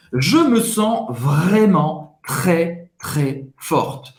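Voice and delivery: slow, drawn-out French, word by word; slow dictation. Shown as a demonstration. Teacher dictating the phrase for note-taking, slow dictation. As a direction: dictate it deliberately, stretching each word.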